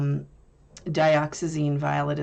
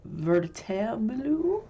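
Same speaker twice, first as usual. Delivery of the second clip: Verditer Blue with a playful put-on accent, higher; 5 semitones higher, slower than usual. Shown as a demonstration. Trying the paint name in a playful accent. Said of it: "if you say it with an accent".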